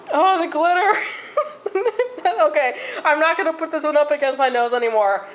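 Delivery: giggling with stinging eyes; laughing. Real, not acted. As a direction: laugh through the words. Glitter in her eyes, laughing.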